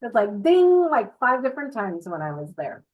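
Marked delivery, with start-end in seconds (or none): 0.4-0.9 high loud bell-like ding; ping it out like a bonk on the lamp; ding sound effect. Retold or imitated. Head bumping the light.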